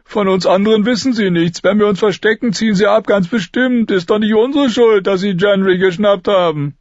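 high-pitched, desperate begging; pleading. A narrator's reading. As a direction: beg them to leave him behind. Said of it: "flehte Lommi".

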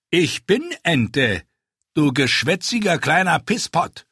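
the big man bellows his name; boisterous rider. A narrator's reading. Big rider names himself, insults.

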